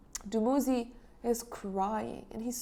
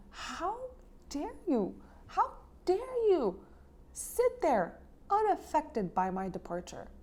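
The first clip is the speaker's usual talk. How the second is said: angry reproach; 7 semitones higher than usual. Retold or imitated. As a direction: read the goddess's shout with indignation, not full volume. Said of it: "She shouted at him. It was the shout of heavy guilt".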